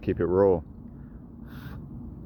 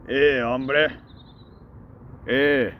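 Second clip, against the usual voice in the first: hammed-up Mexican accent, higher and louder; mock Mexican accent; 7 semitones higher, 3 dB louder, much slower than usual. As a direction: call out hombre in a jokey Mexican accent. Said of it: "with a Mexican accent".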